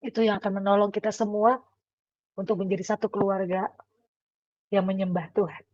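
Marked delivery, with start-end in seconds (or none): none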